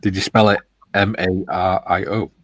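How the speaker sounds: ordinary talk, nothing marked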